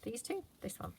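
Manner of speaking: usual speaking voice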